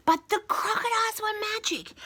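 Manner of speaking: high announcing tone; spring the surprise; dramatic twist. A narrator's reading. Storyteller to kids revealing magic crocodiles, high dramatic.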